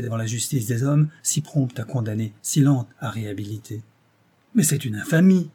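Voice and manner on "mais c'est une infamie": higher, louder cry of outrage; indignant outcry. Acted. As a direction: burst out, scandalized. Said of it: "s'écria-t-elle".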